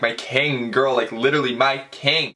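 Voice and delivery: ordinary delivery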